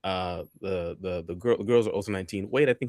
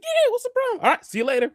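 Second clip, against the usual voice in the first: squeaky breezy; 5 dB louder, faster than usual. Retold or imitated. Oblivious cheerful dismissal.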